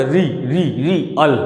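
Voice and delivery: री repeated alone, then अल; syllable breakdown. Shown as a demonstration. Teacher counting throat jolts, syllables said separately. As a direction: sound out रियल as री and अल, low. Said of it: "गले से बोल रहे".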